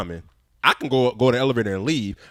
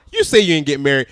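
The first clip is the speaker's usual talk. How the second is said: ordinary talk, nothing marked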